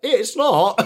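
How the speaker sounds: high, loud stern reply through a laugh; stern retort. Retold or imitated. Dad answering the giggling kid, stern with a laugh. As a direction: shut it down like a fed-up dad.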